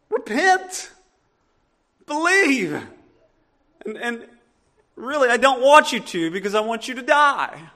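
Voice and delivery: hammed-up reluctant prophet; Jonah's grudging message. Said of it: "the most unattractive message of all".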